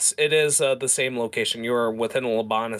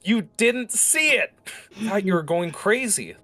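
high-pitched exasperated protest; 9 semitones higher than usual. Acted. Servant telling master he missed the Hydra, exasperated. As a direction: plead your case in a high, worked-up voice.

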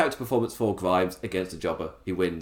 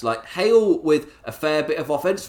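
ordinary talk

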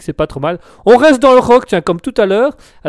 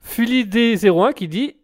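ordinary talk

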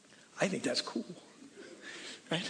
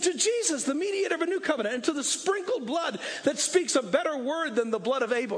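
rally the church like a coach at a game; full-force preaching; 8 semitones higher than usual. Acted. Proclaiming the new covenant.

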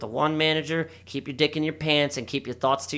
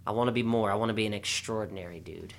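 glum muttering to self; 5 semitones lower, 8 dB quieter than usual. Retold or imitated. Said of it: "I was like".

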